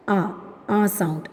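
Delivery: short "ah" for A, not the letter name; A as vowel sound. Shown as a demonstration. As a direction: say A as "ah", like the vowel in gun. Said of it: "A sound is written as". (A എന്നത് "അ" ശബ്ദമായി)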